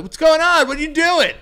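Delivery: high, loud mock call-out; yelling across street. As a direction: holler as if to someone over the road. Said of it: "yelling at each other from across the street".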